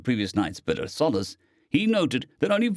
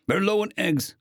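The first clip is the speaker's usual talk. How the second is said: pleading; 8 semitones higher than usual. A narrator's reading. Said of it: "with an almost pleading tone".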